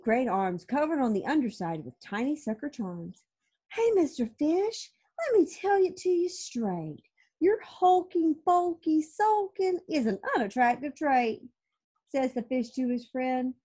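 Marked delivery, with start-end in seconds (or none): 3.7-11.4 high, frank octopus voice; blunt octopus. Acted. Octopus lecturing the sulky fish, high blunt voice. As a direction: tell it straight like a pushy octopus.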